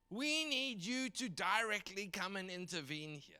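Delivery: slow, high cry to God; acted prophet's drawn-out appeal; impassioned prophet. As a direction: plead with heaven, drawing out each word. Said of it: "he gets really just mad and he shouts".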